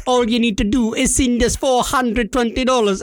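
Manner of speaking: imitated Jamaican scammer; Jamaican accent. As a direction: state the fee in a put-on Jamaican accent. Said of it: "pot, Jamaica".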